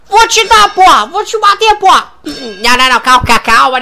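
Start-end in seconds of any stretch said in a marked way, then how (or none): 0.1-2.1 higher, barked mock threat; mock tough threat. Negão Jackson threatens Donovan with a mock bark. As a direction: threaten like a cartoon thug.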